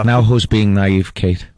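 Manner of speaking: low, slow, mouth barely opening; young Michael voice. Retold or imitated. Michael to Kate, lightly voiced low mumble. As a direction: mumble it through a nearly shut mouth. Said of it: "to do the voice again".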